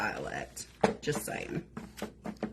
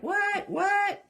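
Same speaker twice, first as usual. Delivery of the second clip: confused dad. Retold or imitated. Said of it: "He's like".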